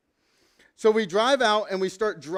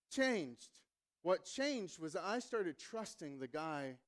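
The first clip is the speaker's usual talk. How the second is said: reflective preaching; 3 semitones lower, 12 dB quieter than usual. Acted. Pastor drawing the lesson of trust, soft and reflective. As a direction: draw the lesson out quietly. Quiet sermon application.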